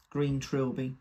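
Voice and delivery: usual speaking voice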